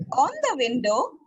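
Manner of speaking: ordinary talk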